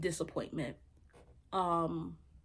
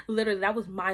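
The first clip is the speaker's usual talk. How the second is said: laughing; 7 dB louder, faster than usual. Real, not acted. Laughing at her own mindset.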